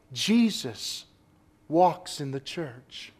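low, slow and hushed; a reverent near-whisper about Jesus in the church; hushed awe. Real, not acted. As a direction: lean in and say it softly, with wonder.

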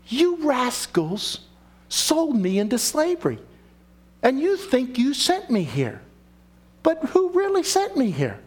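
lightly voiced Joseph rebuking his brothers. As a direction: play Joseph chiding the rascals, then asking who sent him.

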